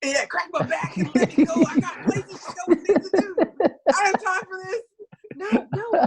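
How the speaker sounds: high, loud, laughing mock patient voice; mock impatient patient. Patient voiced while explaining to a colleague, high laughing mock rush. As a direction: ham up a pushy patient in a hurry, laughing.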